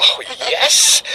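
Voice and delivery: delighted yes. Acted. Boy delighted she agrees.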